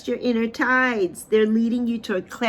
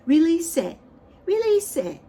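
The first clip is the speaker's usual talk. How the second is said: higher and louder, pressing "release"; emphatic command; 7 semitones higher, 4 dB louder than usual. Acted. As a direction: urge them, push the word release up high. Urging listener to let go.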